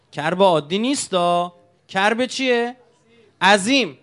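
عظیم hammered loud and drawn out; great calamity stressed. Acted. Preacher to congregation, stressing عظیم loudly. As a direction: lean hard on عظیم. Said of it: "preacher stresses great calamity".

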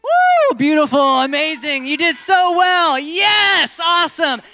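loud high cheering praise; excited praise. Real, not acted. Host cheering the volunteers after they succeed. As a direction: celebrate them at the top of your voice.

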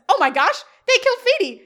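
loud, high mock-horrified yell; hammed-up shriek of alarm; shocked outcry. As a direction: cry out in exaggerated horror, voice leaping up. Acts out Eric's shocked outcry.

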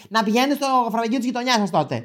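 high put-on voice, retorting; mock-indignant high retort; imitated snippy clerk. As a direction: snap back like an irked clerk. Clerk retorts about the price.

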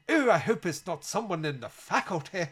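mock a well-to-do English dean's accent; upper-class English accent. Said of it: "middle to upper class English".

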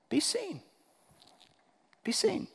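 high, soft voice; light, high near-hush; hushed quote. Retold or imitated. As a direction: say it softly, up high, with pauses.

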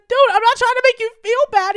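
loud, very high retold protest; emphatic protest. Customer reassuring barista about being alone, emphatic protest. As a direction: imitate a flustered, insistent objection.

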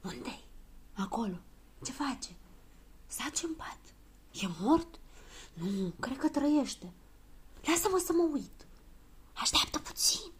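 hushed voices behind a door; whispering. A narrator's reading. Children peeking at a patient behind the door, whispering. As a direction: whisper furtively, taking turns. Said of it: "pași și vorbe șoptite".

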